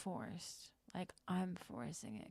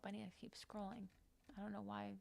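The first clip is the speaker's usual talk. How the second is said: normal talking voice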